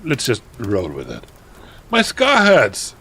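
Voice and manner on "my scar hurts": high, loud, stretched-out cry of pain; hammed-up scream. A narrator's reading. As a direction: wail it melodramatically. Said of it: "he started to scream".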